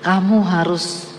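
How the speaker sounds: God telling her to go into surgery, low and solemn. Retold or imitated. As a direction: speak as God, low and quiet. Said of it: "Tiba-tiba Tuhan ngomong sama saya".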